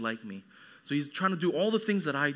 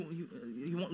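normal talking voice

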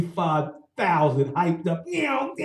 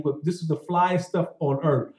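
ordinary talk

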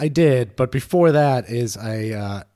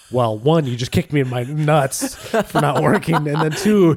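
louder, half-laughing mock outrage; mock indignant; 3 semitones higher, 5 dB louder than usual. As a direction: play up the wounded pride. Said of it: "She didn't say it exactly like that".

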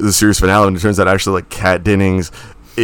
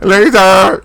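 badly faked cartoon voice, high and loud; Jar Jar Binks; 10 semitones higher, 5 dB louder than usual. Co-host failing at a Jar Jar Binks voice, high loud squawk. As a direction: squawk it high in a failed impression. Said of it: "I can't do a fucking Jar Jar Binks voice".

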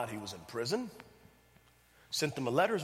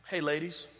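normal talking voice

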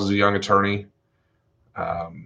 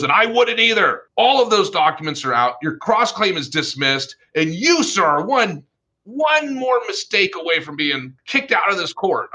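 stern angry judge; 10 semitones higher than usual. Retold or imitated. Judge scolding the defense attorney, angry and stern. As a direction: lay down the law, building to a warning.

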